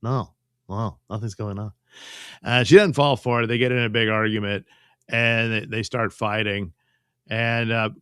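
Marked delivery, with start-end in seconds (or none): 0.0-1.7 a hint of a lying boyfriend, excuses spilling out; lightly voiced con man pleading innocence; smooth-talking cheater caught out. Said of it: "And he's like".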